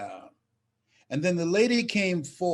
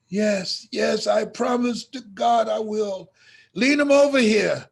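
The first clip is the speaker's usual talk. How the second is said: fervent vow; 7 semitones higher, 6 dB louder than usual. Retold or imitated. Mama swearing promise emotionally.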